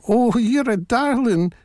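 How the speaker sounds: put-on high-pitched old granny voice. A narrator's reading. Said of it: "Granny said".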